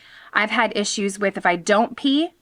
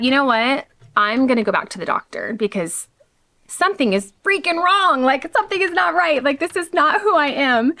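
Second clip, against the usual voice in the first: frustrated inner voice; 5 semitones higher than usual. Retold or imitated. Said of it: "And I thought".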